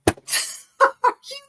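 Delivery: very high, laughing and half crying; retelling a complaint to her partner with a laughing cry; laughing disbelief. Retold or imitated.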